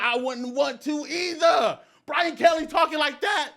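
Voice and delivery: loud, high, hyped exclamation; fired-up shouting. Real, not acted. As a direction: raise your voice in amazed hype. Fired up over coach's boast.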